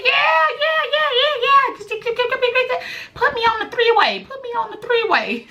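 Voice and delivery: excited mock-eager squeal; eager excitement. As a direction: jump at the offer eagerly. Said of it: "I said".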